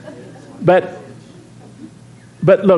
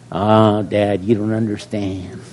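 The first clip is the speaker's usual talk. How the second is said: lower, softer mock whine of a daughter; teen daughter; 9 semitones lower, 5 dB quieter than usual. Pastor imitating his daughter brushing him off, mock whine. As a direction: brush off dad like a sulky teen. Daughter quoted whining back.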